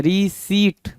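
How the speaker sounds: रिसीट split slowly into "ree-SEET"; careful pronunciation. Shown as a demonstration. Teacher showing how it is read, रिसीट in two long syllables. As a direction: draw out both syllables of रिसीट, no P. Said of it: "रिसीट पढ़ते हैं".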